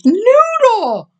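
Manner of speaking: high, thrilled exclamation; excited. A narrator's reading. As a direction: burst with joy for the news. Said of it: "Mama exclaimed".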